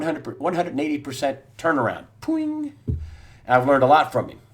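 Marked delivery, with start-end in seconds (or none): 2.2-2.7 cartoon boing. Sound effect for a sudden turnaround.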